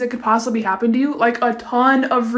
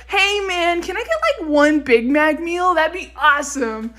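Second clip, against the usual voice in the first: American cow voice; 3 semitones higher than usual. Pretend. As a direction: play a laid-back American dude. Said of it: "American cows be like".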